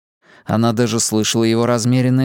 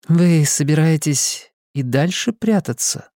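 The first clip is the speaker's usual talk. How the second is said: woman's voice; 4 semitones higher than usual. Acted. Simona challenges hidden stranger.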